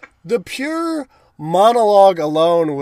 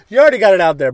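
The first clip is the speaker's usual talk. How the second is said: pushy mock plea; 4 semitones higher, 9 dB louder, much faster than usual. Bender asks a stranger about his seam.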